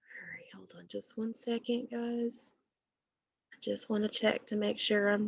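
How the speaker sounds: whispered real aside to the class; quiet aside. Real, not acted. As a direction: drop to a whisper while checking the recording. Quick aside to check recording.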